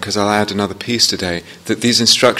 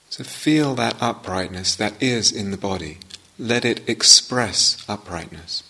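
guided meditation. Real, not acted. Teacher guiding students into upright posture, unhurried meditation voice. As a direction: ease each phrase out, leaving space after it.

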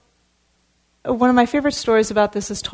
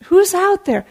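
calling out; 9 semitones higher, 7 dB louder than usual. Acted. Buddha calling out again.

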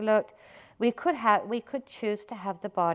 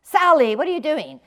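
high, loud startling call; sudden shout; 7 semitones higher, 10 dB louder than usual. Acted. Teacher suddenly shouting at a listener with closed eyes, sharp shout. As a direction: shout it out to jolt someone awake. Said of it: "one of us suddenly shouted out".

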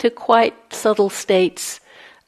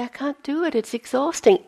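complaining; 8 semitones higher than usual. Retold or imitated. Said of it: "going back to Joseph and saying".

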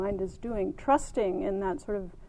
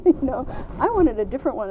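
usual speaking voice

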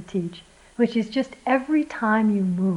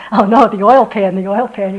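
retold panic; 9 dB louder than usual. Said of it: "Wes is going".

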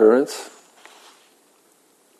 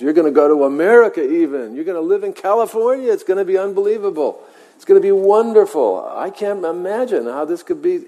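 high, fast, gushing with joy; ebullient; 9 semitones higher, faster than usual. Retold or imitated. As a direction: rave like a thrilled fortune teller. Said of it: "And he's so excited about this".

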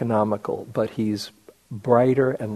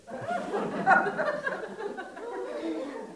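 delighted laugh; 8 dB quieter than usual. Real, not acted. Delighted laugh after teacher's quip.

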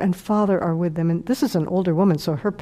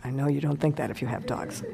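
ordinary delivery

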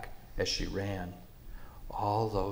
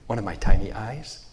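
louder startled question; shocked; 8 dB louder than usual. Acted. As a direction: gasp it out in disbelief. Mouse shocked.

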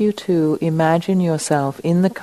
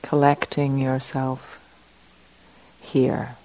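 slightly lowered, soft meditation voice with long pauses; guided meditation; 3 dB quieter than usual. Acted. Teacher settling the group into the room, guided meditation. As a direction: settle them in gently, leaving space between phrases.

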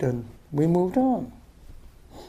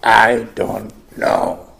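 put-on frustrated child; 5 semitones lower, 4 dB louder, much slower than usual. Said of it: "most frustrated".

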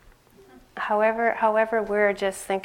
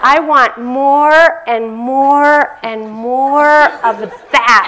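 put-on very slow, loud, higher little-girl voice stretching each "more"; greedy child; 4 semitones higher, 10 dB louder, much slower than usual. Niece insisting to her aunt, loud slow childlike pleading. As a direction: drag out every "more" like a hungry toddler.

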